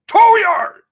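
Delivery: loud outraged cry on tow yard; indignant yell repeating tow yard; outraged shout. Real, not acted. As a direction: shout it in disbelief.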